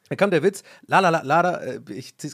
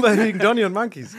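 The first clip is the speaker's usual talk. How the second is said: laughing punchline; 6 semitones higher, 6 dB louder than usual. Pretend. Comedian punchline voice.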